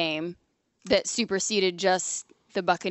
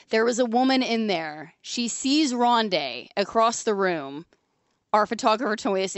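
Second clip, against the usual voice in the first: usual speaking voice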